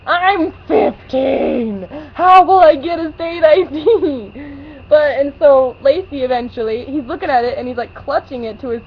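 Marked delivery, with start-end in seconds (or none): 0.1-4.3 very slow, stretched exasperation; fed-up teen protest, stretched slow; exasperated protest. Retold or imitated. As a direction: drag out the disbelief. Exasperated retort to man.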